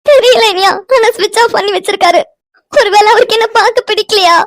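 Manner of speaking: high-pitched tearful wail; crying. Acted. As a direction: sob the words out in distress. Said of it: "Vino, அழுகாதடி". (அழுதுகொண்டே புலம்பும் குரல்)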